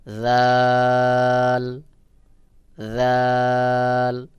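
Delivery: জাল said thin, held very long; thin sound. Shown as a demonstration. Teacher models the Arabic letter, thin drawn-out জাল. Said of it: "পাতলা করে উচ্চারণ হবে".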